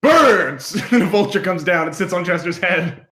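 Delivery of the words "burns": summoning call. Acted. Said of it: "then he just goes".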